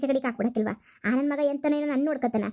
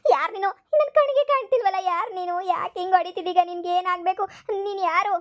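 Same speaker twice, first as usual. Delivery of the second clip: high, anxious pleading questions; frightened questioning; 5 semitones higher than usual. Acted. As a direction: beg the unseen hitter to show itself. Grandma scared of invisible attacker.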